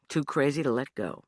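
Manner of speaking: plain reading voice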